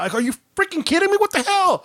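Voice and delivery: high, incredulous exasperation; exasperated. Retold or imitated. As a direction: throw up your hands at the spider. Frustrated at dodging spider.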